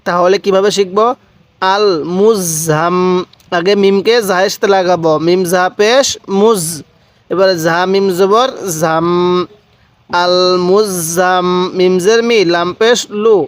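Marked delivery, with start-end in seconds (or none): 1.6-3.0 "আল মুজাম" very slow, final m held long through the nose; ghunnah. Shown as a demonstration. Teacher showing learners the obligatory ghunnah, slow nasal hold. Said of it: "মিমে নুনে তাসদিদ গুনাহ করা ওয়াজিব". 10.1-11.5 "আল মুজাম" very slow, final m held long through the nose; ghunnah. Shown as a demonstration. Teacher showing learners the obligatory ghunnah, slow nasal hold. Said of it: "মিমে নুনে তাসদিদ গুনাহ করা ওয়াজিব".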